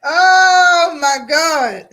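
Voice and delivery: slow, loud, drawn-out disbelief; stunned oh my God. Real, not acted. Reacting to the boxer's rant, a slow loud stunned gasp. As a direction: let it stretch out in shock.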